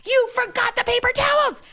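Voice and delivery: high, loud shrieking complaint; imagined angry yell. Retold or imitated. Spouse coming home yelling about forgotten paper towels, shrill and loud. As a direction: yell at your partner over paper towels. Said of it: "and they're like".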